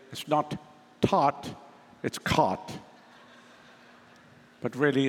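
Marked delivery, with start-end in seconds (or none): 0.1-2.8 put-on American accent with open "ah" in "taught" and "caught"; imitated American accent. Preacher mimics how his congregation would say it, imitated American accent. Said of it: "You would say".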